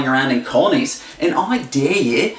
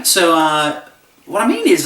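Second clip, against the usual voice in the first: ordinary talk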